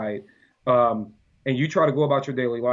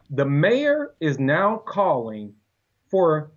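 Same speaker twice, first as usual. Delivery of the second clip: slow emphatic; much slower than usual. Real, not acted. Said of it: "slow deliberate punchline".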